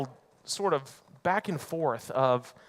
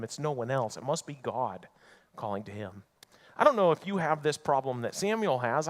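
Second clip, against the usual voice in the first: usual speaking voice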